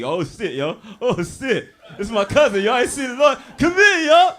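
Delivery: loud, high, excited yelling with a lisp; excited cousin with a lisp. Retold or imitated. Cousin spots him and hollers a lisping, excited greeting. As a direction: greet the cousin like a long-lost hero. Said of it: "He got a lisp and shit".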